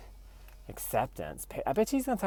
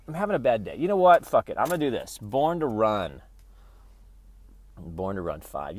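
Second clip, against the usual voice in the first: ordinary talk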